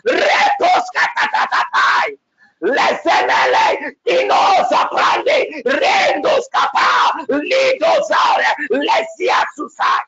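high, slow, crying shouted tongues; ecstatic shout. Real, not acted. Prophet at the climax of prayer, high crying shout. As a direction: let it break out at the peak.

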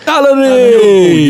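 slow, high, loud cheer of a name; excited greeting. Real, not acted. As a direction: call out a friend's name with delight.

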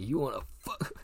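hammed-up dare, losing it to a laugh; mock tough challenge. Repeats the mock threat, laughing.

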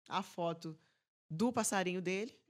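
ordinary delivery

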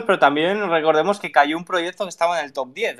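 ordinary delivery

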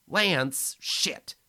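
high, loud alarmed cry; a sudden scared exclamation; startled outburst. Acted. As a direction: caught out, blurt it. Lars startled.

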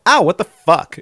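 high, loud fake ouch; mock pained yelp. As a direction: yelp it like you just got hit. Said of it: "and she's like".

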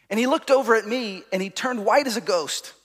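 ordinary delivery

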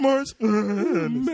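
high slow voice cracking on purpose; mock voice crack. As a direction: exaggerate the crack, slowly. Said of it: "it went".

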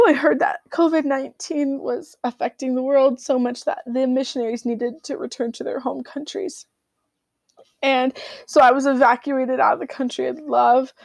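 tearful voice near sobbing; choked-up retelling through tears; crying. Real, not acted. Recalling forced evacuation, crying.

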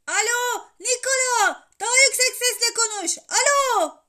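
high, very loud demand down the phone; insistent boyish bellow; shouting. A narrator's reading. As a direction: yell to be heard on the line. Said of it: "diye yine bağırmaya başladı Alcest".

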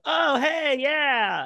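high, loud surprised hello; a startled, high, loud hello; surprised greeting. Retold or imitated. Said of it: "I was like".

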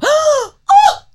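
high loud imitated gasp; dramatic gasp. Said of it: "you go".

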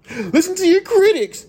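hammed-up high mock of fans telling him to listen; mocking fan demand.

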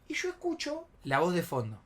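plain reading voice

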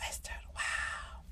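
soft stunned whisper to herself. Real, not acted. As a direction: breathe it under your breath. Stunned at own slip.

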